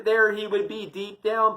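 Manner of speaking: plain reading voice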